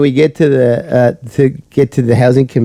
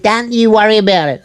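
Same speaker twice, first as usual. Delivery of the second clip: mock defensive retort; 8 semitones higher than usual. Acted quarrel, woman snaps back.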